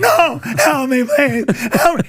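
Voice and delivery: high, loud, slow desperate pleading; terrified begging. Retold or imitated. Gangster dragged to the chair, screaming for help. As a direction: beg for your life.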